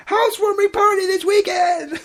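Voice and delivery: high, loud squeal of party excitement; excited outburst. A narrator's reading. Pinkie bursting out with party news, loud and high.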